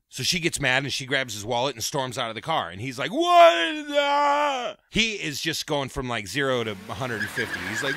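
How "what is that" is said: very high, very slow drawn-out shriek; long high pained wail; agonized shriek. Retold or imitated. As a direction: stretch it into a howl of pain. Doug in burning pain.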